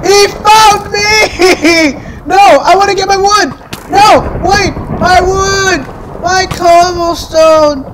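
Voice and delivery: high, panicky whining cry; panicked wail. Real, not acted. Player losing wood and cobblestone, panicked wail. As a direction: wail in dismay, pitched well up.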